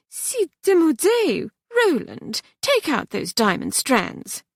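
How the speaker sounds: put-on high French-accented sniffing disdain; haughty French mother. Mother sniffing at the daughter's plain ring, haughty high French accent.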